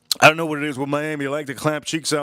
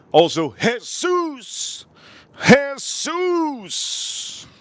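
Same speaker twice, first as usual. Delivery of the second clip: drawn-out exclamation; 11 semitones higher, much slower than usual. Real, not acted. Praising the food dramatically.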